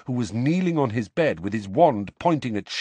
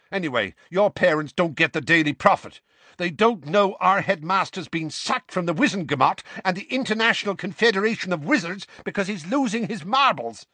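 heated rant; 9 semitones higher than usual. A narrator's reading. Seamus continuing the row.

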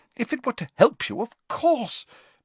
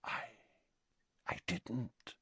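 much softer, hushed and shaken; 4 semitones higher, 15 dB quieter than usual. A narrator's reading. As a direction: breathe it in a hush. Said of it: "said Slughorn in a hushed voice".

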